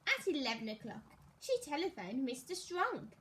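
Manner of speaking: ordinary delivery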